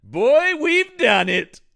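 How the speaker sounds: loud, high mock triumph; hammed-up movie scientist's cry of success; triumphant Frankenstein. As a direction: exclaim it, building to a stretched shout. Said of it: "And he's like".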